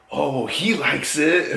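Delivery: high, loud, drawn-out imitation; imitated girl. Voices the girl's reply.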